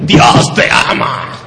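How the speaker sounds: harsh, voiceless drawn-out yell; mock angry preaching. Shown as a demonstration. Interpreter showing the wrong way to preach, mock angry preaching. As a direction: force it out slowly as a rough shout. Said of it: "Is this the right way to preach".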